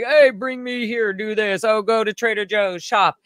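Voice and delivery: lightly voiced demanding rich nephew; bossy nephew. Nephew sending her on errands, mock bossy. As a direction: reel off orders, starting high.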